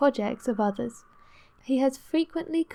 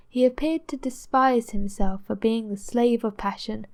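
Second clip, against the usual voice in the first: ordinary delivery, nothing marked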